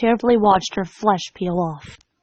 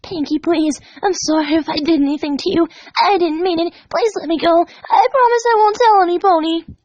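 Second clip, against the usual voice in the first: desperate pleading; 7 semitones higher than usual. Acted. Dash pleading with the smiling pony to let her go. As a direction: beg through tears. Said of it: "Dash pleaded".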